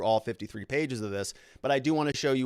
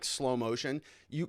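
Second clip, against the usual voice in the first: usual speaking voice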